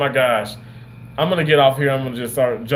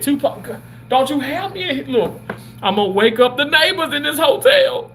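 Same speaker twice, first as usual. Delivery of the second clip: playful call-out; 11 semitones higher than usual. Real, not acted. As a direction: call out to him across the room, half-laughing. Calling out loudly to Tupac.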